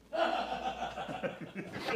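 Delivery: soft, wheezy laughter; breathy laugh. Real, not acted. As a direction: laugh quietly at your own joke. Laughing at own joke.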